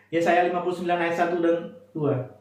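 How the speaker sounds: usual speaking voice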